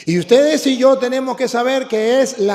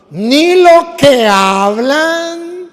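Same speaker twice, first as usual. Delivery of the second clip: "ni lo que hablan" loud, higher and stretched very slow; slow drawn-out dictation; 6 semitones higher, 8 dB louder, much slower than usual. Shown as a demonstration. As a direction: spell it out slowly for the crowd to echo. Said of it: "Otra vez".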